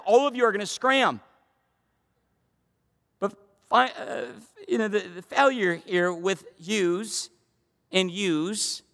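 ordinary talk, nothing marked

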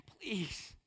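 put-on a soft, near-breathless "Please" from a small boy; quiet plea. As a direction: beg gently like a child who doesn't understand. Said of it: "And him begging".

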